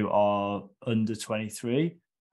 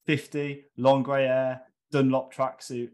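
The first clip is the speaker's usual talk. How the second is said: ordinary talk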